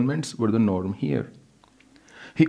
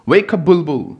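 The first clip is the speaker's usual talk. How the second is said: higher, louder frantic wake-up call; urgent call; 8 semitones higher, 8 dB louder than usual. Acted. Mother waking her daughter in panic, loud and urgent. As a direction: rouse the child urgently.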